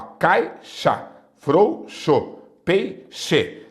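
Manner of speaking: "caixa, frouxo, peixe" broken as cai-xa, frou-xo, pei-xe; split after diphthong. Shown as a demonstration. Teacher showing X after a diphthong, split by syllable. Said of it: "após ditongo, use o X".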